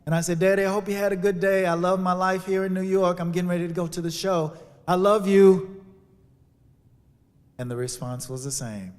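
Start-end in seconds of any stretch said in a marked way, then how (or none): 0.4-5.8 bright, fast, louder cheerful call. Retold or imitated. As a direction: sound upbeat for dad. Cheerful calls to father.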